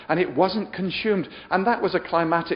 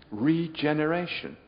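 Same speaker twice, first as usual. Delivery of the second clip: normal talking voice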